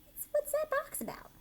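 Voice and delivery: put-on high, wondering child voice; curious kid voice. Children asking their teacher about the box, high and curious. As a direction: ask like a puzzled little kid. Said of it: "they're like".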